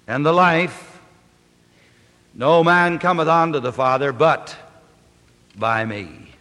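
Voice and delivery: measured, solemn reading of the text; scripture reading. Acted. Preacher reading scripture aloud, solemn. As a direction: read the Gospel to the congregation. Preacher reads John fourteen.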